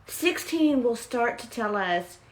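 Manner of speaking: ordinary delivery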